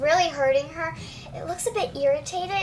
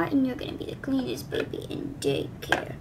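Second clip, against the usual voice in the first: whisper; 3 semitones lower, 7 dB quieter than usual. Acted. Cooing to baby doll.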